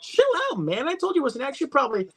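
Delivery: high-pitched hammed-up excuses; acted defensive movie rant; mock protest. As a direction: argue it high, in character. Acting out film dialogue.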